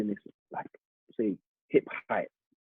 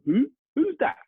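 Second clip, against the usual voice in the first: mock puzzled fan; 5 dB louder than usual. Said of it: "hear someone in the video go".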